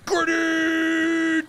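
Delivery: high, loud, long-held yell; shouted callout. Real, not acted. Gamer throwing a grenade, high sustained shout. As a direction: holler it and stretch the last vowel.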